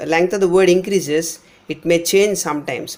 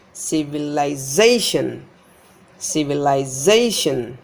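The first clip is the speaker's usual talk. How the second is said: civilization as civi-li-ZAY-shun, long ZAY; low start, punch ZA in civilization; stress before suffix; 3 semitones lower than usual. Shown as a demonstration. Teacher showing suffix rule, civilization stressed on ZA. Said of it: "This Z is stressed".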